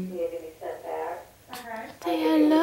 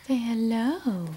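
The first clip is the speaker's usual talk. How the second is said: ordinary talk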